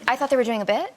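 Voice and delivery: normal talking voice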